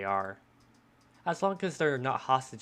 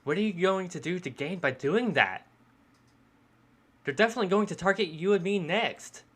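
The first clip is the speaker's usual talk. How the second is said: higher, animated, worried protest; urgent pleading character; 7 semitones higher, 4 dB louder than usual. Acted. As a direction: plead urgently, pitch rising as you warn. Voices character pleading urgently in dialogue.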